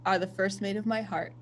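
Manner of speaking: gentle, lowering to a soft close; tender reassurance. Acted. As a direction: soothe a child-like crewmate. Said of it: "She takes his cheeks and she's like".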